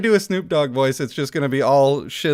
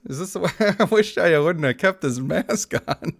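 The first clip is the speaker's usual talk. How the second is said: ordinary talk, nothing marked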